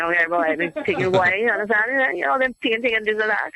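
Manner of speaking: broad Jamaican Rasta patois; ragamuffin Rasta. Shown as a demonstration. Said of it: "the ragamuffin Rasta was saying".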